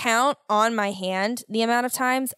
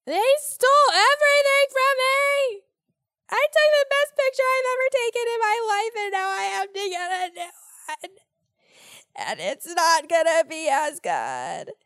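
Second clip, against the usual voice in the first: high, wailing mock cry; mock sobbing wail; 13 semitones higher, 4 dB louder than usual. Retelling the DMV meltdown in a high sobbing wail. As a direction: wail it like a hammed-up sob. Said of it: "hyperventilating, crying, screaming".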